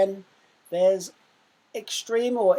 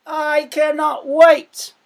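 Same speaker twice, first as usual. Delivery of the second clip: high, loud, slow excited cry; intense excitement; 10 semitones higher, 15 dB louder, slower than usual. Shown as a demonstration. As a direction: burst out with eagerness. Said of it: "show a bit of intense and intense emotion".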